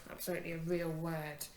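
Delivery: ordinary talk, nothing marked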